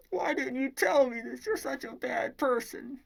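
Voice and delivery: high, loud mock wail of accusation; hammed-up shrieking woman berating him; distraught outburst. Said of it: "And she's like".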